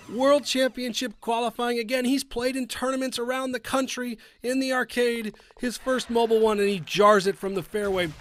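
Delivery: high, out of breath after screaming; breathless excitement. Real, not acted. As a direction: catch your breath but stay hyped. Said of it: "Very excited".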